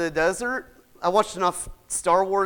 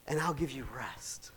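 whispered, much softer promise; hushed promise; 6 semitones lower, 15 dB quieter than usual. Acted. God promises Moses rest, in a tender whisper. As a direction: breathe it like a gentle promise. God's promise softly voiced.

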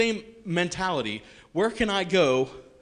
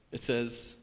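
ordinary talk, nothing marked

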